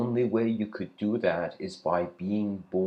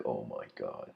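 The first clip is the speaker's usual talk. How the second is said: low, hushed dawning realization; stunned quiet voice; 8 semitones lower, 11 dB quieter than usual. Acted. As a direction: drop low and soft, awestruck. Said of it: "And the robot's like".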